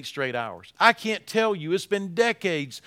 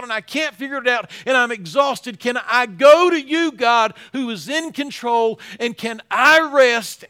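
desperate plea; 5 semitones higher, 7 dB louder than usual. Acted. Preacher voicing David's desperate plea.

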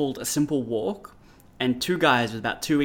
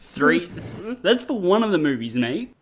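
incredulous; 5 semitones higher, 4 dB louder than usual. Real, not acted. Incredulous friend.

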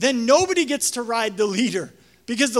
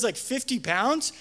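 normal talking voice

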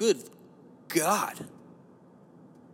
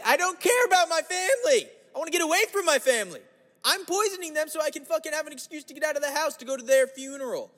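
much higher, hammed-up fed-up voice; mock fed-up person; 14 semitones higher than usual. Imagined relative fed up with family, mock high voice. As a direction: play a cartoonish person desperate to flee family.

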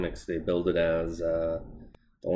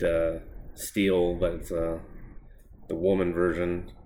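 normal talking voice

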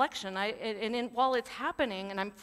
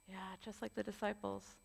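usual speaking voice

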